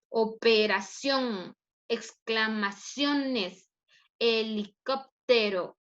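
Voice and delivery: slow word list, each stressed syllable drawn out; syllable-stress demo. Shown as a demonstration. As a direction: pronounce each word slowly, leaning on its strong syllable. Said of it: "Vean la fuerza".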